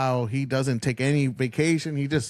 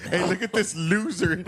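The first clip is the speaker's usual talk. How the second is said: slow, higher mocking call; slightly higher teasing party voice; mocking partygoer; 6 semitones higher than usual. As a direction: show off the loser to the room. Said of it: "He's like".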